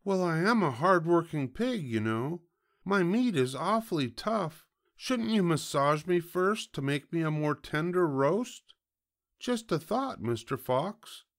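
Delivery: very high piglet voice; wheedling piglet. Acted. Piglet talking the fox into a bath, high and wheedling.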